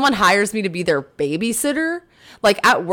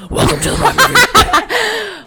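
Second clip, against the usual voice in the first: high, slow posh show intro; introducing the wine segment in a mock posh voice; fancy host; 11 semitones higher, 4 dB louder, slower than usual. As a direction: play the snooty sommelier host.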